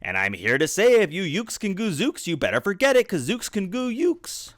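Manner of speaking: high-pitched boasting call; yelled taunt. A narrator's reading. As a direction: jeer at the enemy, pitch climbing high. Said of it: "and he yelled".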